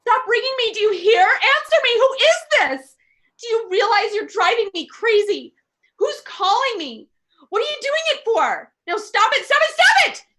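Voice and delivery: a frightened woman yelling at a silent caller; hysterical shouting. Acted. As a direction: scream at the phone to stop.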